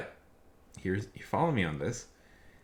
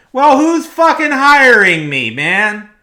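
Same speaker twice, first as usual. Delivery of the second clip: much higher, much louder, slow outburst; exasperated shout; 20 dB louder, slower than usual. Retold or imitated. Worker shouting back at a customer over jobs, exasperated yell. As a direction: yell it out in frustration.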